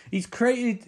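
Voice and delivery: ordinary delivery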